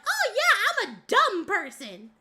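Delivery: high, swooping country bumpkin voice; ditzy, high-pitched Southern caricature, sing-song at the end; dumb country girl. Pretend. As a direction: play a hammed-up, dim-witted country girl. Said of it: "very idiotic Bessie, Bessie characters".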